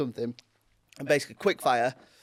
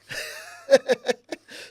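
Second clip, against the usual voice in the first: high unvoiced laugh; burst of laughter; 11 semitones higher than usual. Real, not acted. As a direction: laugh out at the joke. Laughs at own pun.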